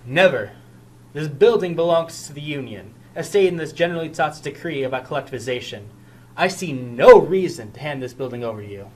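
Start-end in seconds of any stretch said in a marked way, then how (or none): 0.1-0.5 firm refusal. Acted. Refusing police takeover of building. 6.4-8.9 defiant refusal. Acted. Refusing police takeover of building.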